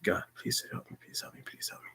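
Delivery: breathless whispered plea, almost no voice; whispered prayer. Retold or imitated. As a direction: beg God under your breath. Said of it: "I'm just praying".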